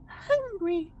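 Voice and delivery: a soft, eerie plea; soft monster voice. Acted. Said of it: "a softer voice of".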